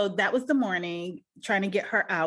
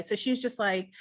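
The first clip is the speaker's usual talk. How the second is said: usual speaking voice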